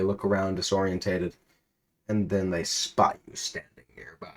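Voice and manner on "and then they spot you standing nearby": hushed whisper; whispered suspense. A narrator's reading. Narrator building tension, whispering. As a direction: lean in and whisper it.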